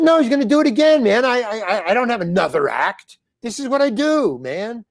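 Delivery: agitated protest. Retold or imitated. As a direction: rush it out like a rattled young comic begging his manager. Pleading with manager after bottle attack.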